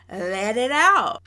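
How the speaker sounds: a hammed-up condescending taunt; condescending, drawn out. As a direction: stretch it out slowly to mock her goading. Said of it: "so condescending and rude".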